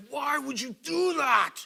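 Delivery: put-on loud, high, slow furious shout at the child; retold outburst of pain and rage, yelled; angry yell. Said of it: "super intense and angry".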